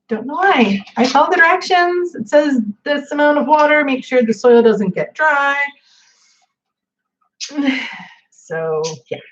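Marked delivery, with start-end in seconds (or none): 0.1-5.6 higher and a bit louder, exasperated; a frustrated plant owner listing the rules; exasperated protest. Real, not acted. Frustrated plants keep dying. 7.4-8.0 a long tired exhale; a resigned sigh; weary sigh. Real, not acted. After plant complaint.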